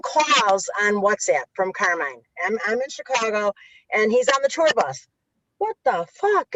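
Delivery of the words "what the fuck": high-pitched New York accent impression; Carmine's New York accent. Friend retelling a call from the tour bus, mock New York accent. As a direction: imitate an exasperated New Yorker, pitched high. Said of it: "in his New York accent".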